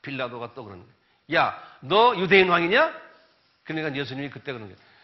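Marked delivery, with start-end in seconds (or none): 1.3-2.9 loud slow demand; challenging Pilate. Retold or imitated. Preacher voicing Pilate questioning Jesus, loud slow. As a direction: call him out slowly and loudly.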